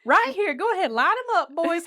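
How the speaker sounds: high loud teasing holler; hollering at the boys, egging them on; mock loud callout. Retelling the boys heckling her, high mock holler.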